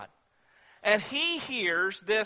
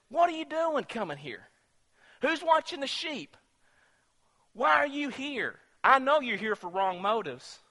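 mock angry scolding; 6 semitones higher than usual. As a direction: scold a younger brother, acted. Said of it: "he gets mad and angry at David".

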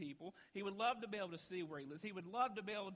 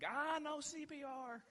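much higher, drawn-out retold call; neighbor's urgent offer; 9 semitones higher, slower than usual. Nurse neighbor runs up offering CPR, high urgent voice. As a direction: voice the woman who came running, pitched way up. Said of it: "She's like".

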